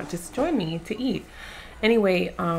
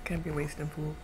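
talking while chewing; speaking with food in mouth; mouth full. Real, not acted. Seen 2: Food put in mouth at -2; lips closed, chewing while speaking.

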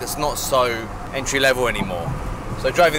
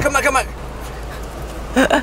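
high, insistent repeated call; high-pitched pleading call; urgent calling back; 13 semitones higher, faster than usual. Real, not acted.

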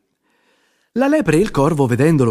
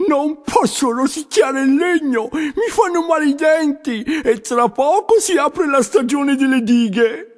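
high, slow, desperate plea; a young beaver crying out that he can't chew; 12 semitones higher than usual. A narrator's reading. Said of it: "Il povero Arturo era proprio disperato". (castoro disperato, voce acuta e lenta)

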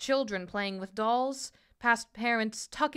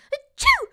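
loud, high spoken "achoo"; sneeze; 11 dB louder than usual. A narrator's reading. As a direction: burst out with an acted sneeze. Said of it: "she sneezed".

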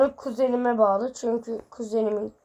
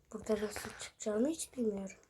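whisper; 3 semitones lower, 11 dB quieter than usual. Real, not acted. Oyun içinde kendi kendine fısıldıyor.

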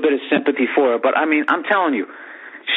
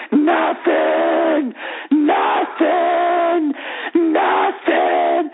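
screaming; 11 semitones lower, much slower than usual. Retold or imitated. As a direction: bellow it at the back of her head. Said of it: "He just starts screaming".

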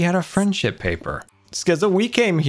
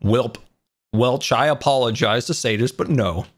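put-on Canadian accent; 8 semitones lower than usual. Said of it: "That was my Canadian accent".

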